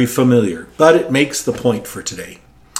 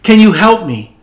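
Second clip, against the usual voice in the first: higher, louder plea; pleading; 8 semitones higher, 8 dB louder than usual. Retold or imitated. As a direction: beg God for help from the cliff. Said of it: "So he pleaded".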